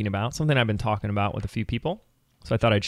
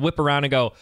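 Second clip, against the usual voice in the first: ordinary talk, nothing marked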